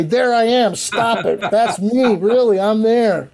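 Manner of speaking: half-laughing, begging friends to notice; insistent high pleading. Retold or imitated. To friends watching footage, insisting it's him on screen. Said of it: "I had to say".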